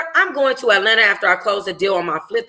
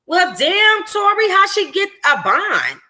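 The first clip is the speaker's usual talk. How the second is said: higher, incredulous best friend; Dior's voice; 11 semitones higher than usual. Acted. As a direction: squeal it in disbelief. Dior reacts in disbelief.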